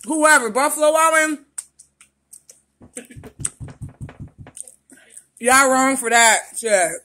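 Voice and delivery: loud complaining through the burn; venting loudly at the heat; mouth burning from spicy food. Real, not acted. Wings burning his mouth.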